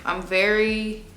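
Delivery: normal talking voice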